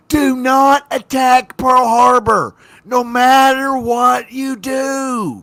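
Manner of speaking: loud slow shouted warning; shouting. Retold or imitated. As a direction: yell it slowly down the phone. Hitler imagined phoning Japan.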